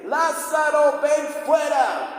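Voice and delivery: loud, high, drawn-out shout; commanding shout. Retold or imitated. Jesus at the tomb calling Lázaro out, booming acted shout. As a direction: summon the dead at full voice.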